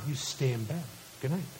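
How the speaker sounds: very soft, low goodnight; hushed bedtime voice. Retold or imitated. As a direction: settle a small child for the night. Dad settles daughter back to bed.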